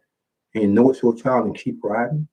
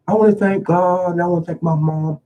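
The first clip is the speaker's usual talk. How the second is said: higher, gushing mock acceptance speech; lightly mocking a celebrity thanking God and mom, higher and eager; award-show thank-you; 8 semitones higher than usual. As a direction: gush it like a star at an awards ceremony. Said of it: "when they do these awards ceremonies".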